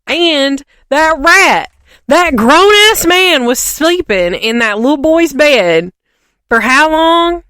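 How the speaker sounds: high, loud, slow disbelief at the rat; high, loud outraged rant, the first words drawn out slowly; indignant outrage. Real, not acted. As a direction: rant it like you can't believe it. Indignant rant about Pettigrew.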